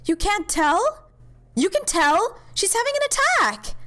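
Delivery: high, alarmed outburst climbing at the end; acted alarm. Companion warns that the doctor is having an attack, alarmed. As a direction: raise the alarm, pitch shooting up.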